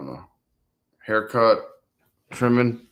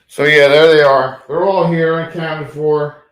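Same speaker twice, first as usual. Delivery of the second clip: much louder, presenting them; louder proud roll call; loud announcement; 3 semitones higher, 12 dB louder than usual. Real, not acted. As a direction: boom it out to the room.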